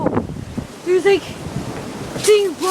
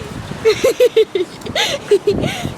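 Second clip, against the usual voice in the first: giggling laugh; 6 semitones higher than usual. Real, not acted. Laughs with the dog.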